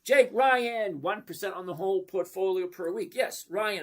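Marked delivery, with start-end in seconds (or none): none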